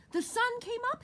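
very high, excited little squirrel; chirping squirrel. A narrator's reading. Happy squirrel announces the sunrise, chirped very high. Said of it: "he chirped".

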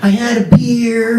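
mock the buddy who won't come out; whiny reluctant friend. Comic telling the crowd how he whined, mock-whiny. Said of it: "and I would go".

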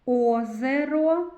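slow, loud Озеро with both О sounds stretched; drawn-out vowels. Shown as a demonstration. Teacher slowly sounding out Озеро for pupils. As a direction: stretch each О in Озеро so children can find them. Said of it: "У слові озеро звук О стоїть".

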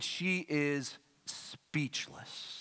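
slow, fading into a whisper; dramatic hush. Acted. Preacher describing Mary surprised, hushed awe. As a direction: draw it out and let the last word drop to a whisper.

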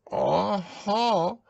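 high, thin, shaky aha; shaky Piglet voice. A narrator's reading. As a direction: squeak it out after a fright. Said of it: "as well as he could after his terrifying journey".

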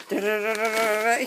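rapid-fire "da-da-da" drum roll; vocal drum roll before a reveal; spoken drum roll. Pretend. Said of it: "drum roll".

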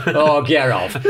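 scoffing disbelief. Retold or imitated. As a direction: imitate sailors loudly scoffing. Sailors scoff at his claim.

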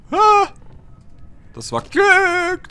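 high, loud startled cry; startled shout. Real, not acted. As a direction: yelp with relief, stretched out. Lucky jump, startled. (erschrockener Aufschrei)

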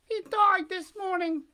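put-on high, squeaky wooden-boy voice; high puppet voice. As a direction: pipe it up like a puppet.

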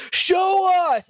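high, drawn-out heckle; heckler's mock shout. Audience member heckling the comic about tattoos, high mock shout. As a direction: imitate a guy calling out from the crowd. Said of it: "And I yell back at him".

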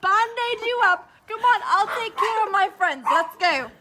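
boisterous take-charge holler; loud, emphatic reassurance; loud mock rescue. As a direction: project it like a playful rescuer. Loudly rallying to tend friend.

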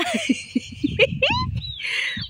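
high amused giggle. Real, not acted. Gardener laughing at her own accent, giggling. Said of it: "laughs at own pronunciation".

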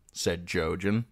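plain reading voice

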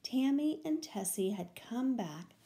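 ordinary delivery, nothing marked

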